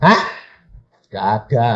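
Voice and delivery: ordinary talk, nothing marked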